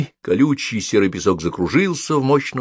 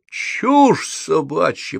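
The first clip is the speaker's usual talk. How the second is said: slow, raised-pitch dismissive retort; roaring; 7 semitones higher, much slower than usual. A narrator's reading. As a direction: roar it back, stretching each word. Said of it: "проявил Холл". (протяжно взреветь)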